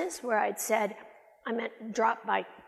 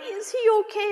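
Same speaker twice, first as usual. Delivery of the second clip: much higher and louder worried plea; alarmed question; 11 semitones higher, 7 dB louder than usual. Retold or imitated. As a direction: ask the wife in alarm.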